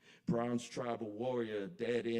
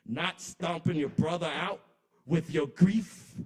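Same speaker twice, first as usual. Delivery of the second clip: each question louder and higher than the one before; building impassioned cry; 4 semitones higher, 8 dB louder than usual. Acted. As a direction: climb from a loud ask to a pleading shout.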